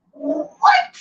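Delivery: high, squeaky disbelieving what; stunned high-pitched question; surprised. Real, not acted. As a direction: shoot the word way up in disbelief. Amazed at seventeen thousand views.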